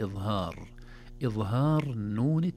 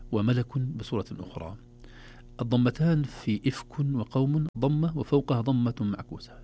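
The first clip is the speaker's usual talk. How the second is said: ordinary talk, nothing marked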